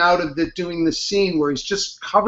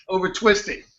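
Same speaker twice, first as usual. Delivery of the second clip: ordinary talk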